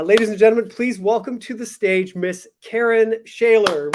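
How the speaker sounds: loud, high stage announcement; podium speech. Acted. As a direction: announce the guest to a big crowd. Host announces guest to stage.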